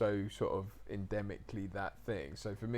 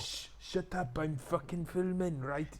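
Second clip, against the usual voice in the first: higher, slow Welsh director's orders; Mark Price; 7 semitones higher, 4 dB louder, slower than usual. Retold or imitated. Director hushing his set while filming, bossy Welsh accent. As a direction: take charge of the set in broad Welsh. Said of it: "He said".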